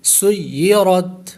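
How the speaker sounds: سیرت recited slowly, seen joined to the doubled ya; tajweed recitation. Shown as a demonstration. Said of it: "را پر ہوگا".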